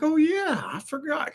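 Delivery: high-pitched retold "oh yeah!" moment; sudden realization. Streamer recalling a forgotten habit, sudden high realization. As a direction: act out remembering, voice way up high. Acting out own sudden recollection.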